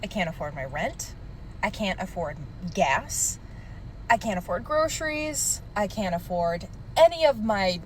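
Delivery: ordinary talk, nothing marked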